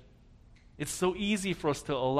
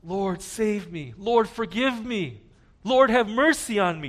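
crying out to the Lord; 6 semitones higher, 3 dB louder than usual. Retold or imitated. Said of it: "you cried out to him".

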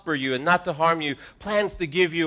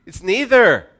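higher, louder on neither; emphatic punch; 6 semitones higher, 9 dB louder than usual. Real, not acted. Pastor driving home the answer, loud and emphatic. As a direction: land it hard.

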